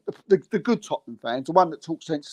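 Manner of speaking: ordinary delivery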